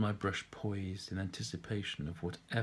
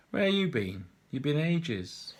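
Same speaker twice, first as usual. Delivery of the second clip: slow, raised London chiding; Londoner; 4 semitones higher, 3 dB louder, slower than usual. Acted. Londoner in the pub teasing the painter, slow and raised. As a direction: drawl out the complaint like a pub mate.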